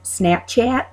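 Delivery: "Snapchat" slow, flat Illinois a; Midwest accent. Retold or imitated. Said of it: "One thing I've heard a lot of is".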